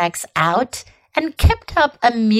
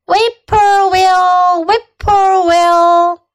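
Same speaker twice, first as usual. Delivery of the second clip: slow, high, loud birdsong call; bird call; 8 semitones higher, 6 dB louder, much slower than usual. A narrator's reading. A bird singing from across the road, slow high call. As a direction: draw out each syllable like a bird calling.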